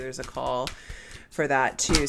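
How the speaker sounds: ordinary talk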